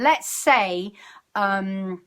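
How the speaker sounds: usual speaking voice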